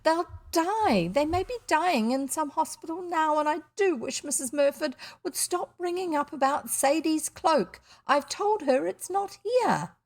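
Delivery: high-pitched, fraught fretting; almost distraught. A narrator's reading. Mother fearing for missing children, almost distraught. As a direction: fret like a mother at her wits' end. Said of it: "almost distraught".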